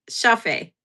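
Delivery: ordinary delivery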